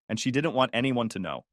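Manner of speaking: usual speaking voice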